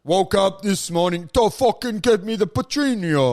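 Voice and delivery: put-on tough-guy mobster Italian accent; other Italian type. Comic showing the other Italian, mobster accent. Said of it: "And then there's the other".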